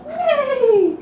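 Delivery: put-on high neigh for the baby; horse neigh. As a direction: echo a bright neigh. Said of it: "that's right".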